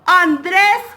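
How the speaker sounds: delighted surprised reveal; gasp of surprise. Real, not acted. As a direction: gasp as you read out the winner. Gasping reveal of winner.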